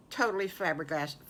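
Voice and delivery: plain reading voice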